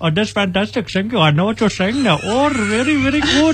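put-on high, delighted Indian-accented praise; Indian translator voice. Translator thanking the preacher in an Indian accent. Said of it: "the translator was like".

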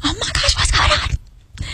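high, fast, breathy panic fading to a whisper; retelling her panic in the sauna, very high and hushed; panicked. Retold or imitated. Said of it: "I was like".

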